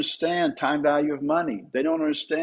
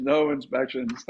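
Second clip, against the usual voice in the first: ordinary talk, nothing marked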